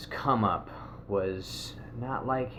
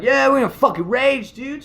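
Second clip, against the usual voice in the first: much higher, louder excited shout; hyped yell; 10 dB louder than usual. Retold or imitated. Friends shouting to him that they're at a rave, excited.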